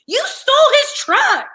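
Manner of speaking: high, laughing disbelief; retold incredulous retort.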